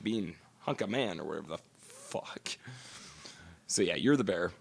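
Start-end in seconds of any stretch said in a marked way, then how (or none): 0.0-2.6 whispered mocking aside; muttered aside. Real, not acted. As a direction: drop to a mock whisper. Mocking aside about being called hunk.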